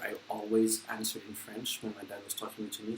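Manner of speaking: ordinary talk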